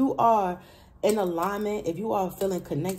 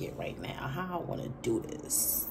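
whispered aside; 9 dB quieter than usual. Real, not acted. Whispered aside while choosing.

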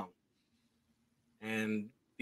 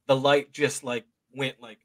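ordinary talk

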